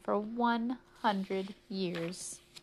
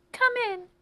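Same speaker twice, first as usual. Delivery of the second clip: high, loud invitation; distressed old lady; 6 dB louder than usual. Acted. Old lady invites the policeman inside, distressed. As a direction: call him in from up high.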